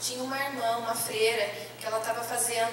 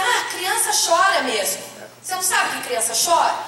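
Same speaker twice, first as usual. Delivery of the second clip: dismissive nurse; 5 semitones higher, 6 dB louder than usual. Retold or imitated. Nurse dismisses crying baby.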